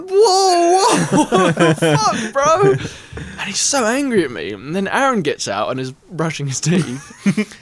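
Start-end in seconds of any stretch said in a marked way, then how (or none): 0.1-2.7 laughing, high, loud whoa what the fuck; shocked shout. Retold or imitated. Drunk camper shouting at an angry man who burst out, laughing shock. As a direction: yell in disbelief at the stranger. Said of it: "I'm going".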